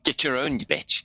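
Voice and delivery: high-pitched mock indignant retort; jealous voice in head. Voice in Tacklin's head rebuffs the newcomer, hammed-up indignation. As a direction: play a possessive inner voice seeing off a rival. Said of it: "how do the other voices in Tacklin's head respond".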